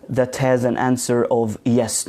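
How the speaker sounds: usual speaking voice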